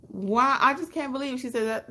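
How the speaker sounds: ordinary talk, nothing marked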